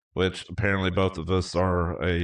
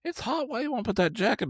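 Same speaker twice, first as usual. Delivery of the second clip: high-pitched mock girlfriend voice, quick at the end; imitating fiancée. Imitating his fiancée asking about the jacket, in a high mock voice. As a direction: mimic her in a much higher voice, teasing. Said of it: "She's like".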